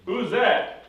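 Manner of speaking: loud, high-pitched demand; sharp challenge. Retold or imitated. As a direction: call out suspiciously, acting the father. Blind father challenges the visitor.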